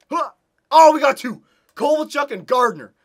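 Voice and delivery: very high, very loud joyous yell; ecstatic cheer. Real, not acted. Gamer cheering loudly as his team scores twice. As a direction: burst out cheering.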